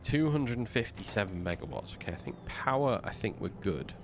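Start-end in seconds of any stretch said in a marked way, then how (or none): none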